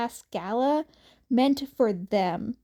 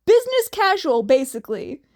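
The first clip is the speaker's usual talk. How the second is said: loud blurt; 4 semitones higher, 7 dB louder, faster than usual. Acted. Character blurts an answer.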